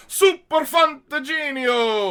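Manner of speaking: high, loud and drawn out; excited. Pretend. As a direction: belt out the title with glee. Excited naming of favourite film.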